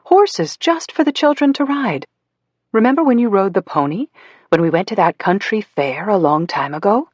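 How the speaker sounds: very high, syrupy sing-song voice; sickly sing-song. Acted. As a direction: sing it out like talking to a toddler. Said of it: "in a sickly, sing-song voice".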